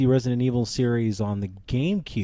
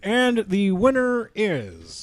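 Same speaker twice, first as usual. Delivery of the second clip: slow suspense drag; drawn-out reveal; 5 semitones higher, much slower than usual. Pretend. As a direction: stretch it for suspense. Award reveal, slow echo.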